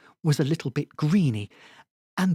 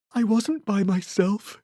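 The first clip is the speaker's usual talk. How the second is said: yawned line; 6 semitones higher than usual. A narrator's reading. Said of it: "he yawned".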